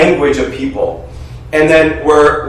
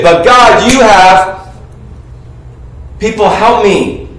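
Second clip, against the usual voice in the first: ordinary talk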